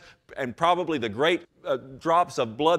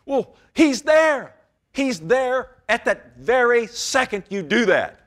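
high, loud, driving sermon voice; preaching at full force; 7 semitones higher, 4 dB louder than usual. Real, not acted. Preacher pleading with sinners in church, loud and high. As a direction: pound the point home to the congregation.

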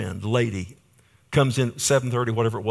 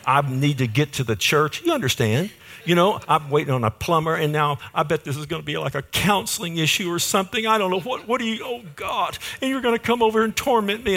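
worked-up inner complaint, pitched high; exasperated mock rant; 7 semitones higher than usual. Pastor waiting on a plumber dreads a visitor, exasperated comic rant. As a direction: let the mock exasperation climb higher and higher.

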